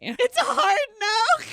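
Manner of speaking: high, slow laughing echo. Retold or imitated. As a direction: repeat it through laughter.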